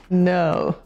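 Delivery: ordinary delivery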